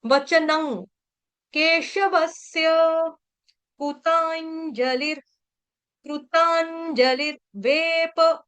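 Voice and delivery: वचनं with a final ङ sound, slow; teacher breaks the verse into words; slow syllable demo. Shown as a demonstration. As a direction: chant each word slowly and clearly. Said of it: "उसका उच्चारण होगा ङ".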